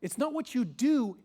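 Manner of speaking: usual speaking voice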